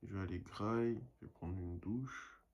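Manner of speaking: usual speaking voice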